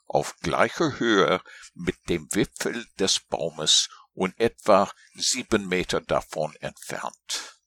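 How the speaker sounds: ordinary delivery, nothing marked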